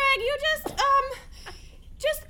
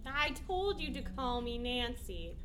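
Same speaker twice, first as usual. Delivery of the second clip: low seductive; 9 semitones lower, 10 dB quieter, slower than usual. Acted.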